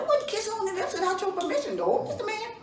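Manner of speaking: high, fast pleading voice. Acted. Doofus assuring Mister Man he is innocent, high and fast. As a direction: squeak out a frantic denial.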